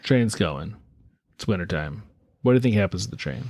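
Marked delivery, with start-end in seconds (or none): none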